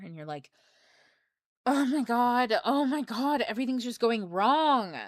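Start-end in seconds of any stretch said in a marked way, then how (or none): 1.6-5.0 a hammed-up wail of despair, higher and louder; mock exasperated. Someone who grabbed the wrong cup, mock despairing and louder. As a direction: whine like everything is ruined. Voicing irritated person in hell.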